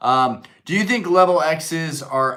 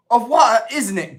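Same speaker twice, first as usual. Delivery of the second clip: put-on British accent; 11 semitones higher, 7 dB louder than usual. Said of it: "just botch that up".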